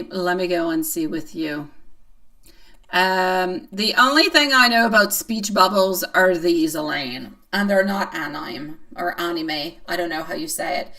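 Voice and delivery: normal talking voice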